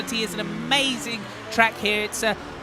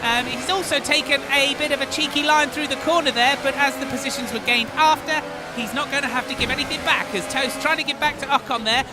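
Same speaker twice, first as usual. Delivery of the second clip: high, loud race call; motorsport commentator; 7 semitones higher, 5 dB louder than usual. Acted. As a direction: call the action live.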